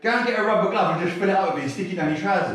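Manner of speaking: high, quick Welsh-style imitation; Welsh accomplice. Welsh accomplice explaining a smuggling trick, high and quick. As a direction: rattle off the trick in mock Welsh. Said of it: "I said to this Welsh police".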